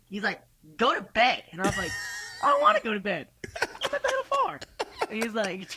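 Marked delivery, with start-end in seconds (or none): 0.8-1.4 raised, higher-pitched mock command; firm, loud imitation of a telling-off; retold stern order. Retold rock star's order to turn in. 2.4-4.6 put-on very high, squealed drunken objection; mock whiny protest. Retells own drunken protest at bedtime.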